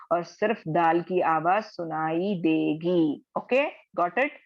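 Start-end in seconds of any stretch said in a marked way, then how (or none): none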